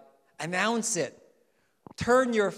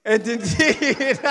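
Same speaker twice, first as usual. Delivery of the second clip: laughing; 5 semitones higher, 11 dB louder, much slower than usual. Real, not acted. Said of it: "I'm not gonna ask for a practice of that anymore".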